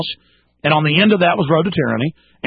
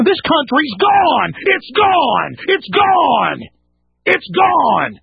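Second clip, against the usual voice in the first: high, drawn-out cries of alarm; real ranting shout; 9 semitones higher, much slower than usual. Real, not acted. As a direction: cry it out repeatedly, stretching the last word. Host rants that the country is lost.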